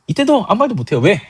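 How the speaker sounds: ordinary delivery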